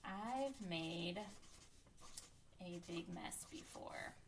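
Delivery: soft, very drawn-out words with gaps; slow, quiet self-dictation; writing aloud. Real, not acted. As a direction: murmur each word as you write it. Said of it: "my thinking voice is going to just write".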